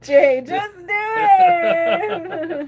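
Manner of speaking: loud, drawn out, laughing; laughing shout. Real, not acted. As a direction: cheer him on through laughter. Egging him on laughing.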